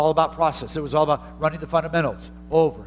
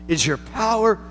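high, loud preacher's cry; full-voiced sermon peak; preaching at full force; 7 semitones higher, 3 dB louder, slower than usual. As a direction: lift it and preach it out.

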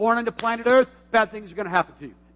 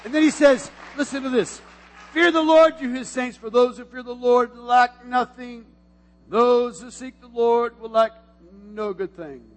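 raised, slow proclaiming of the verse; preaching scripture; 4 semitones higher, 5 dB louder than usual. Acted. Pastor reading the psalm aloud, proclaiming. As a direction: declare the verse to the church.

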